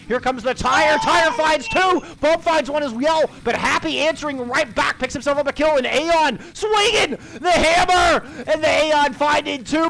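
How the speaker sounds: rising excited play-by-play yell; excited shout. Real, not acted. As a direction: shout the fight call, building. Play-by-play climax of team fight.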